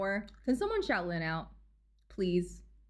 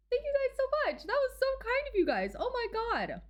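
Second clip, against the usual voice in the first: excited squeal; 12 semitones higher than usual. Real, not acted. Thanking gifters excitedly.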